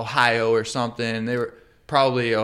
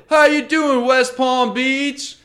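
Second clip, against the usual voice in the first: high, loud, drawn-out showman greeting; hammed-up emcee; 13 semitones higher, 6 dB louder, slower than usual. Mocking a comedian, he bellows a stage greeting. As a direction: shout it like a stage host. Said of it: "They said".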